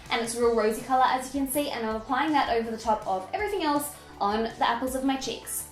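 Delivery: ordinary delivery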